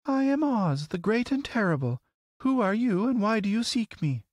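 very high, sweet lady's voice. A narrator's reading. Lovely lady greeting a scarecrow from her throne, sweet. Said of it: "looked upon him sweetly and said".

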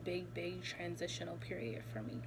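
normal talking voice

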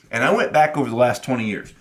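normal talking voice

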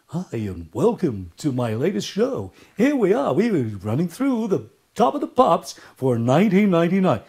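exaggerated radio DJ patter with big swooping pitch; cheesy radio host. DJ welcoming listeners to the chart show, hammed-up radio voice. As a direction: parody an old-style pop radio presenter. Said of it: "if I'm a radio host".

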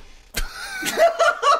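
very high, loud burst of laughter; loud shrieking laugh. Real, not acted. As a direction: explode into a squealing laugh. Laughing at game of crib.

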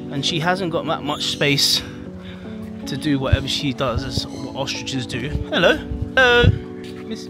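ordinary talk, nothing marked

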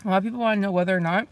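chewing while talking; eating fries while speaking; mouth full. Real, not acted. Seen 3: ate fry at -2.0, lips pursed, chewing while speaking early.

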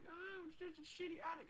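mocking her imagined excuse in a squeaky voice; squeaky Anne Frank. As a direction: whine it in a high squeak. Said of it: "don't even try going".

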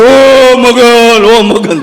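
long stretched mourning cry; mock wail. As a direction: draw out the name like a hired mourner. Mourners wailing at wrong house. (લાંબું ખેંચેલું નાટકીય રુદન)

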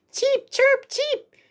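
tiny high chirps; cartoon bird voice. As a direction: chirp like baby birds. Chicks chirping.

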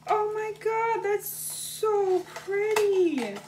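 high, breathless gasp into delighted oh my God; excited gasp. Real, not acted. Gasping with delight as she opens the friend's gift.

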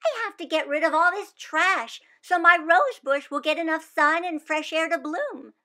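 put-on earnest little girl sure of her plan; hopeful child; eager young Wanda.

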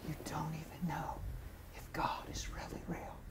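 faint, voiceless whisper; hushed divine whisper. Retold or imitated. God repeating his words to a man working, whispered.

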